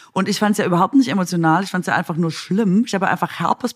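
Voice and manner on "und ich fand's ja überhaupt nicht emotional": usual speaking voice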